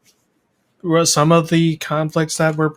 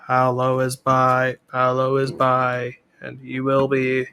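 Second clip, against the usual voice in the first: monotone, repeated like a subliminal message; even, measured mock chant; droning mantra; 3 semitones lower, slower than usual. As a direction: intone it like a hypnotist planting an idea. Chants the character's purpose as mantra.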